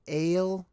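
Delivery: Ale with a long A, as in "sail"; pronunciation variant. Shown as a demonstration. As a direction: say Ale to rhyme with "pale". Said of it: "could be pronounced".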